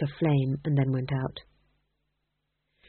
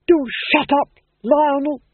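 irritated; 8 semitones higher, 7 dB louder than usual. A narrator's reading. Wife snaps at Duke.

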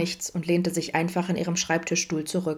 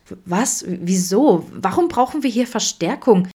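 stammering, higher and louder questions; shocked; 3 semitones higher, 6 dB louder than usual. A narrator's reading. Vincent questions the need for backup, shocked. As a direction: stumble over the words in alarm. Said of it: "Vincent blickte ihm schockiert entgegen".

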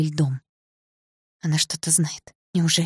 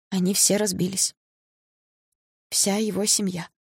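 halting, slightly raised grieving voice; tearful; 4 semitones higher than usual. A narrator's reading. Sasha telling of his family's death, tearful. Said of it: "хриплым от слёз голосом".

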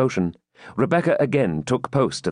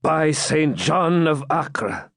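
slow, higher-pitched admiration; joyful oath; 6 semitones higher, much slower than usual. A narrator's reading. Knight rises on couch at good news, joyful. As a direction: savour the oath with delight. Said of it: "raising himself joyfully".

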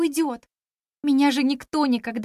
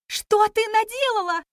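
high, alarmed cry of dismay; 9 semitones higher than usual. A narrator's reading. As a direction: react in shocked alarm. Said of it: "растерялась фея-спасительница".

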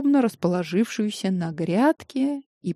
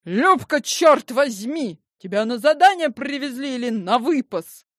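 indignant scolding; 5 semitones higher than usual. Acted. Zinaida scolding Lyubochka.

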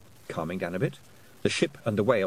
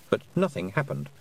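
usual speaking voice

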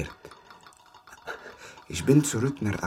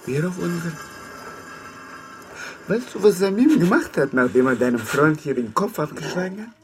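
high and loud, bitter and taunting; angry shout; 12 semitones higher, 3 dB louder than usual. Acted. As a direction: throw it at him like an accusation. Enraged prisoner taunting his captor.

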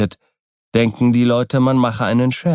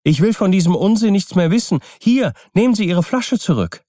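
hoch und hastig protestieren; schneller, deutlich höherer Einspruch; aufgeregter Ausruf; 9 semitones higher than usual. A narrator's reading. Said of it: "rief Keawe". (aufgeregt abwehren, mit hoher Stimme)

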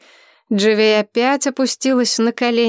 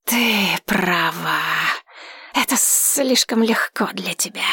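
purring dragon; 3 dB quieter than usual. Acted. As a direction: purr it like a sly dragon. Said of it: "заурчал дракон".

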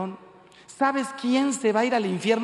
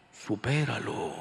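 low, slow divine voice; voice from the tabernacle; 8 semitones lower, 4 dB quieter, slower than usual. Acted. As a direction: intone it slowly, like God answering from the altar. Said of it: "salió una voz del sagrario que me dijo". (voz divina, grave y lenta)

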